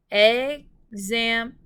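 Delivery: "example" in three slow, loud, separated beats; syllable by syllable. Shown as a demonstration. Teacher tapping out syllables for pupils, "example" split syllable by syllable. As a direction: tap out "example" with a pause after each syllable. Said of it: "Let's tap out the syllables for example".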